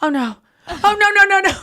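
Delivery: rising from dismay to high, loud alarm; mock panic. Woman realizing her ex has her message, imitated rising panic. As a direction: act out escalating horrified realisation. Retold horror as message is played.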